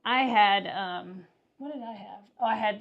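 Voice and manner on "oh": ordinary delivery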